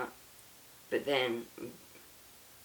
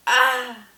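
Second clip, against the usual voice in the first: high, loud cry of "ah!"; anguished scream; 11 semitones higher, 12 dB louder than usual. Acted. Father seeing an arrow hit his son, loud scream. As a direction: scream out as if too late. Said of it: "A scream pierced the air".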